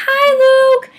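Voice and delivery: very high, loud squealed greeting; excited fan call. Retold or imitated. Fan calling to singer heading into tour bus, squealing. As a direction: holler it in a fangirl squeal.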